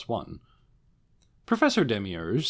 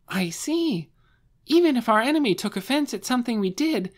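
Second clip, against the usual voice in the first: put-on much higher, louder, excited girlish voice; gushing high girl; 5 dB louder than usual. Shalltear praising Lord Ainz in a high, excited girlish voice. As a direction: gush with breathless admiration.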